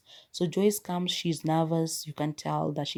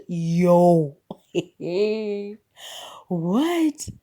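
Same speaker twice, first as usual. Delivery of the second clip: stunned disbelief; 7 dB louder than usual. Retold or imitated. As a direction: recount being floored, drawing out the exclamations. Her stunned reaction to the ending.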